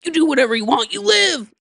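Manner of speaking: loud TV-character impression, climbing to a shout; hammed-up rousing yell; Ted Lasso. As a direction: holler it as joyful permission, rising at the end. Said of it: "and he's like".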